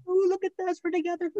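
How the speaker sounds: very high falsetto teasing voice; mock lovey-dovey couple. Host imagining the pair on the couch, mocking them in falsetto. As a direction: imitate a canoodling pair in a squeaky-high put-on voice. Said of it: "they'll probably be on the couch going".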